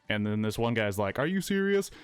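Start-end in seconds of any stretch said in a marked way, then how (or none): 1.2-1.9 mock disbelief. Retold friend reacts to girlfriend's call.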